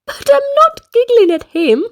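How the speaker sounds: chirpy little giggler; high giggly voice. Acted. Little Miss Giggles explains herself to the manager.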